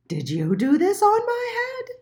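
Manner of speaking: high, loud squeaky question; a small indignant mole demanding answers; little mole voice. A narrator's reading. Little Mole asking the pigeon, high and indignant.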